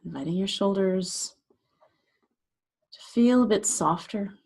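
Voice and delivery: unhurried meditation guide, long pauses between phrases; guided meditation. Acted. Meditation teacher guiding listeners to relax shoulders and jaw, guided meditation voice. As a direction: gently talk them into settling, leaving space after each phrase.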